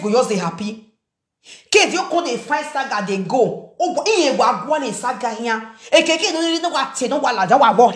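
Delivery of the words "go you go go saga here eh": ordinary delivery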